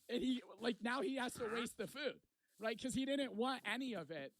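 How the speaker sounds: ordinary delivery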